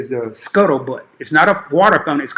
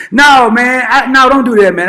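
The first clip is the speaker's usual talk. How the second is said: excited protest; 7 semitones higher, 6 dB louder than usual. Real, not acted. Laughing protest at chat emoji.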